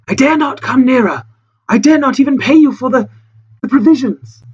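fearful pleading. Acted. Castaway explaining he dare not come nearer, fearful. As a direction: plead with faltering pauses.

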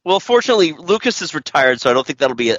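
normal talking voice

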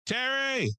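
high, sustained call through a door. Retold or imitated. Calling Terry at his door at night, high and drawn out. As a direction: holler for the neighbor.

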